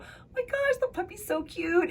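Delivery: squealing high, gushy coo over a cute puppy; mock-gushing baby-talk squeal. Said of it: "and you go".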